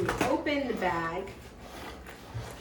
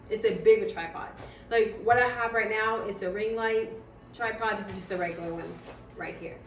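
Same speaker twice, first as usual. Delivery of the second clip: normal talking voice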